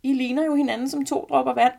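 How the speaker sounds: high, loud squeal of amazement; squealing grandmother. A narrator's reading. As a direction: exclaim shrilly like an astonished grandma. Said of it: "hviner min farmor".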